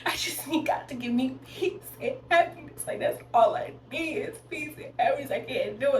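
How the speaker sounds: mock-sob through a desperate prayer; boo-hoo crying. Retelling her prayer at the altar, acted boo-hoo crying. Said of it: "boo-hoo crying".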